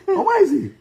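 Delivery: very high, loud mock cry; squeaky mock outrage. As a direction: squeal it in fake indignation.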